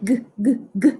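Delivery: hard g sound repeated; throaty g three times; letter sound g. Shown as a demonstration. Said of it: "Tell me three times".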